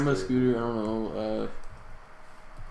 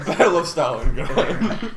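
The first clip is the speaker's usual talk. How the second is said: ordinary delivery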